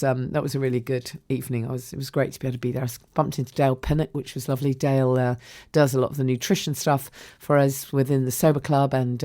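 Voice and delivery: normal talking voice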